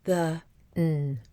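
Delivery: "the" and "n" as two separate sounds; segmented sounds. Shown as a demonstration. Teacher showing how segmenting "then" would sound, segmented sounds. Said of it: "would sound like this".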